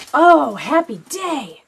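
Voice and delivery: gasp, then a loud, slow, overjoyed cry; delighted gasp. Real, not acted. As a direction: burst out with real joy. Delighted about leftover lunch.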